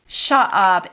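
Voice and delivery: ordinary talk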